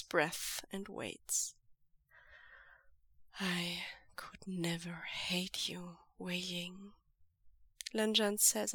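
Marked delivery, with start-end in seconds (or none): 3.4-6.9 slow, much softer sad whisper; tired and sad. A narrator's reading. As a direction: answer gently, worn out. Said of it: "He sounds tired and sad".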